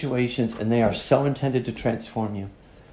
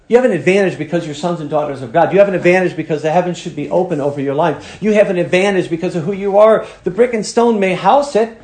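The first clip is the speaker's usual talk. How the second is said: louder, rising preacher's cadence; fiery preaching; 5 semitones higher, 5 dB louder than usual. Acted. Preacher telling the church its true advantage, building. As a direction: hammer each repeat.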